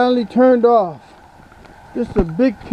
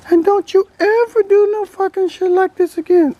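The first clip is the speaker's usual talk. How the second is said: higher, cheeky toy duck voice; duck puppet voice; 10 semitones higher than usual. Acted. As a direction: voice the toy duck answering back. Ducky scolds the owner.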